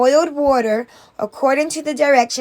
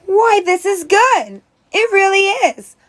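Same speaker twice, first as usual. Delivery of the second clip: exaggerated surprise; 7 semitones higher, 3 dB louder than usual. A narrator's reading. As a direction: act more amazed than you are. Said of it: "with more surprise than necessary".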